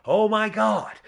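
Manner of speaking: high, mock whiny exclamation; whiny nasal voice. Shown as a demonstration. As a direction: lift it into a nasally whine. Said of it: "it's not the, my usual nasally voice".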